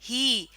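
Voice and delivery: ہی fully sounded with long ee; long pronounced he. Shown as a demonstration. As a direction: say ہی as a long "hee". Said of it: "کو ادا کر رہی ہوں". (ہی پورا اور لمبا ادا کریں)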